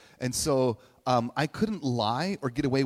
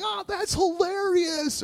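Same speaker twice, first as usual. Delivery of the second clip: high, slow mock delight dripping with sarcasm; sarcastic; slower than usual. Reader mocking a Garfield joke, sarcastic. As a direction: gush it out as a pure put-on.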